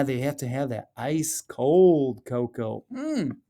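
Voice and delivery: ordinary delivery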